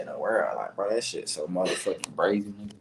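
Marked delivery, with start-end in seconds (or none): none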